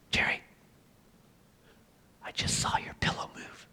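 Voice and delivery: hushed unvoiced whisper of a scared sister. Sister whispering to her brother at night, hushed whisper. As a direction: breathe it like a secret in the dark.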